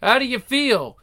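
usual speaking voice

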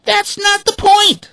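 very high, loud hen voice; indignant hens. A narrator's reading. Hens retorting to the ducks, high. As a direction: insist hotly. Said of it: "the hens replied".

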